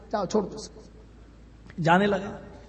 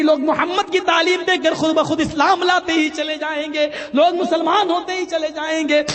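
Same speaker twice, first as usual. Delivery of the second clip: preaching at full force; 6 semitones higher than usual. Acted. Preacher telling the congregation of Umar's embrace, impassioned.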